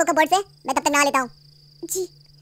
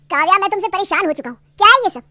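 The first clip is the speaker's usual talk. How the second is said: loud, fed-up outburst; angry scolding; 5 semitones higher, 9 dB louder than usual. Acted. Husband loudly scolding his wife for the mess. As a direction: blow up at her.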